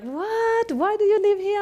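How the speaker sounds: high, incredulous what; baffled disbelief. Retold or imitated. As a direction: act out the puzzled friends in disbelief. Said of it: "and people are like".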